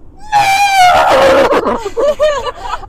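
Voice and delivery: loud whinnying horse noise. Acted. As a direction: neigh like a horse. Horse impression right after announcement.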